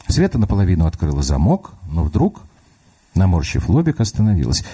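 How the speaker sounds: plain reading voice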